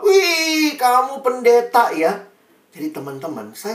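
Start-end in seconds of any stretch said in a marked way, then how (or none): 0.1-2.1 much higher, loud mocking exclaim; teasing friend. Friend mocking him as a pastor, high teasing exclaim. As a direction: tease him like a classmate ribbing a goody-goody.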